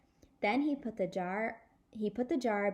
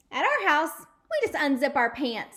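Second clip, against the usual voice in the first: cheeky shout; 6 semitones higher, 8 dB louder than usual. Acted. Sheldon's cheeky joke.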